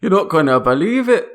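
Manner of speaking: hammed-up Canadian friend greeting; Canadian accent. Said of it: "This is perfect Canadian accents".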